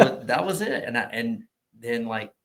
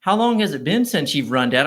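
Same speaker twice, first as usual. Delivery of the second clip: teasing son; 4 semitones higher, 10 dB louder than usual. Retold or imitated. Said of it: "my son said".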